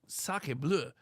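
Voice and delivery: mock French exclamation. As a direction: say it like a shocked Frenchman. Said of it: "and I said".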